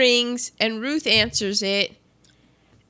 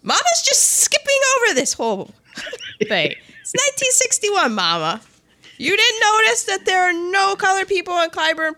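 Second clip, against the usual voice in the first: incredulous; 9 semitones higher than usual. Real, not acted. Outraged at Mama's choice.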